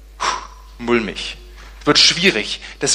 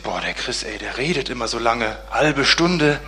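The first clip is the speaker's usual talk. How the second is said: low, quieter mock grumble of a bored churchgoer; grumbling listener; 6 semitones lower, 6 dB quieter than usual. Preacher mocks listeners who find his sermons long, low grumble. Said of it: "denken sich".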